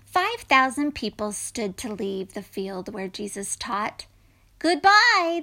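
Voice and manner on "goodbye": loud, high farewell call; high, ringing shout; crowd crying out. A narrator's reading. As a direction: call out goodbye as a grateful crowd. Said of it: "they cried".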